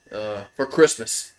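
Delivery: ordinary talk, nothing marked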